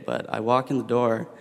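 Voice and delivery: ordinary delivery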